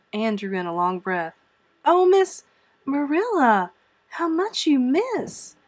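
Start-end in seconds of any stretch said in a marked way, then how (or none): 1.8-5.6 high, loud, drawn-out lament; pitying amazement. Acted. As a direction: marvel dramatically at the loss, pitched high. Anne pities guardian lacking imagination.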